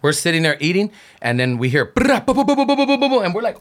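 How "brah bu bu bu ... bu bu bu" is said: rapid high "bu-bu-bu" gunshot noises; staccato mouth-made gunfire, higher than the speaker's own voice; imitated gunfire. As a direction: mimic a burst of shots with the mouth, pitched up.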